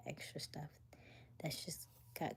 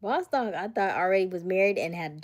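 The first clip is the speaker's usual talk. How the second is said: calling out; 3 semitones higher, 11 dB louder than usual. Real, not acted. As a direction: hail him loudly. Calling out friend in chat.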